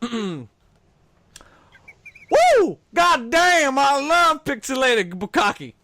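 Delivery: put-on whooping drunk Southern guy, loud and high; southern drunk accent. Card reader hams a drunk Southern guy: loud Southern holler. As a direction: holler it like a drunk Southern good ol' boy. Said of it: "read these answers in that same voice".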